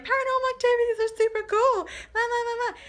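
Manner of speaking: high, carefree sing-song mockery; mock her as a carefree airhead. Mocking the girl alone in the dark, high carefree sing-song. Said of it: "and she's like".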